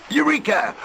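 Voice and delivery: high, bright shout of Eureka; excited discovery. A narrator's reading. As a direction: pop it out like a sudden discovery. Pirate finds the answer.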